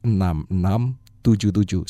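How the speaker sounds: slow, spaced phone number; slow number read. Shown as a demonstration. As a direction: read each digit slowly. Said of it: "Saya ulangi pelan-pelan".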